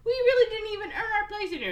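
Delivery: put-on doubtful kids. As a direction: sound like a timid child team.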